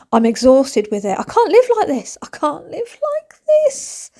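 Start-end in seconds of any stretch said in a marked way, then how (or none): 1.2-2.2 high-pitched, hammed-up despair; over-the-top exasperated cry; exasperated wail. Exasperated, repeats it more desperately. 2.2-4.1 very high, slow mock wail; hammed-up falsetto despair; desperate squeal. Exasperated repeat, drawn out.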